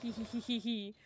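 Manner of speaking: little mischievous giggle; giggly. Real, not acted. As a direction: giggle playfully to yourself. Playful giggle while building character.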